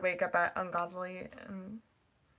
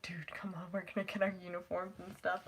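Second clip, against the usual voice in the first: hushed, breathy urging from a friend; hushed imitation of friend nagging; whispered friend quote; 10 dB quieter than usual.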